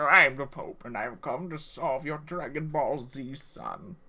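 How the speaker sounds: put-on voice of a cartoon Pope; Uncle Popius the Pope. Game master as the Pope greeting a player, mock-grand role voice. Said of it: "That's what he says".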